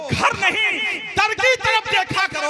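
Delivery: full-voiced poet's shout; shouted climax. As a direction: belt the punchline to the whole hall. Climax of the recited couplet.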